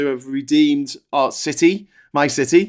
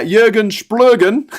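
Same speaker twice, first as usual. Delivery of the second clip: reads the name out slow, higher and louder; hammed-up name read; 8 semitones higher, 7 dB louder, slower than usual. Host savoring a funny donor name, slow and loud. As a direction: relish the silly name, drawn out.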